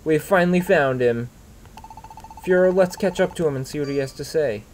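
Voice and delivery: a hint of an eager Pokémon partner; hero's partner voice. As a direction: read it as the upbeat sidekick. Partner dialogue read aloud.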